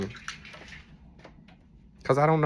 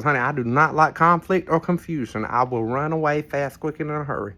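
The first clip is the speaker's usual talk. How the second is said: usual speaking voice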